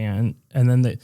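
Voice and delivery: ordinary talk, nothing marked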